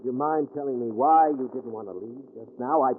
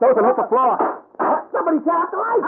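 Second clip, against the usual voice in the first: loud high urgent shout; shouted warning; 8 dB louder, faster than usual. Acted. Detective shouting to Susan as the lights go out. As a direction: yell it across the room.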